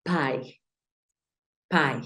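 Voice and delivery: "pai" with the I opened into "ai"; "pai", one gliding syllable; English first syllable. Shown as a demonstration. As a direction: say "pai", rhyming with English "pie". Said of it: "esta primer parte va a sonar".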